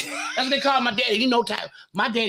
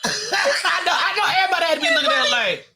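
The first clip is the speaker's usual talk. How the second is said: high, fast talk through laughter; laughing; 13 semitones higher, faster than usual. Real, not acted. Host laughing at the funeral story while talking.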